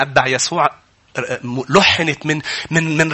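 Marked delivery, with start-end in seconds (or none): none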